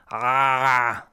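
"Ah" held loud and throaty; open-mouthed ayn. Shown as a demonstration. Teacher likening ayn to biting, "Ah" wide and throaty. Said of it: "you open your mouth big".

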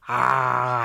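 "ah" held long from the throat, mouth wide; open-mouthed ayn. Shown as a demonstration. Teacher showing ayn, "ah" wide and throaty. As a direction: open up on "ah" as if about to bite. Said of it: "you open your mouth big".

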